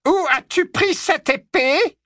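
very high, slow, drawn-out question; menacing interrogation. Acted. Witch interrogates the disarmed werewolf, slow menace. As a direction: draw each word out high and threatening. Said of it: "murmura-t-elle à Greyback".

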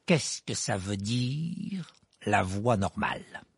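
slow, stern demand on la voie normale; stern uncle questioning Harry about la voie normale. A narrator's reading. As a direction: press him sternly, slowly. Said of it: "lança-t-il d'un air sévère".

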